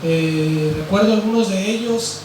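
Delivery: ordinary delivery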